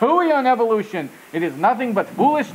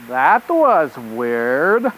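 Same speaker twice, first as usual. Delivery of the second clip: usual speaking voice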